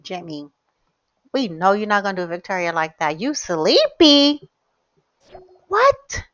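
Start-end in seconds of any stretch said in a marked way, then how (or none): 3.2-4.5 slow, high, drawn-out disbelief; incredulous question. Real, not acted. Gamer confronts Jimmy for being sleepy, incredulous. 5.7-6.2 high squeal of a question; shocked disbelief. Real, not acted. Gamer reacts to sleepy Jimmy, shocked.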